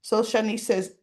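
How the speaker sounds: ordinary delivery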